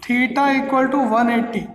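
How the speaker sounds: drawn out slowly; slow dictation. Shown as a demonstration. Teacher dictating condition to class, slow. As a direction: say it slowly while writing.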